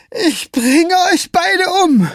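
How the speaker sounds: high, slow, furious roar. A narrator's reading. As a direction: bellow the threat at both of them. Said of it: "brüllte Scapegrace".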